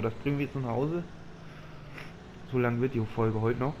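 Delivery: normal talking voice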